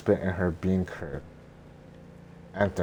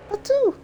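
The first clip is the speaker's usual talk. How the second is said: spitting sound; 6 dB louder than usual. Acted. Spits cricket out.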